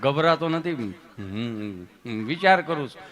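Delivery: low, hesitant patient; Gabho. Acted. Patient telling the nurse he is thinking, low and hesitant. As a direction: hum and ponder in a low voice. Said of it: "અહીંયા ગભો બેઠો હતો".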